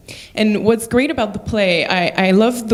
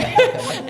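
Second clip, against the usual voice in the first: short high laugh; bright giggle. Real, not acted. Laugh at own story.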